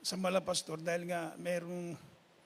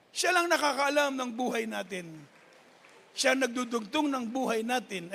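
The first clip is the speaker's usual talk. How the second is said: raised, louder preacher's voice; preaching at full force; 3 semitones higher, 4 dB louder than usual. Acted. Preacher telling the congregation their life is with God, in full force. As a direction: proclaim it to the congregation.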